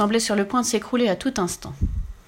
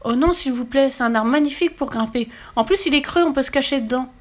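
put-on high-pitched little girl begging; pleading; 6 semitones higher, 3 dB louder than usual. A narrator's reading. Little girl begs to save the climbing tree, pleading. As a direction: plead eagerly in a child's high voice. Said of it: "la supplia à mains jointes".

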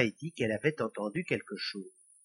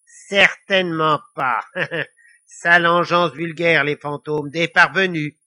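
haughty devil; 8 dB louder than usual. A narrator's reading. Devil disdaining ghosts to Lily, haughty. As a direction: play a devil affronted by the question.

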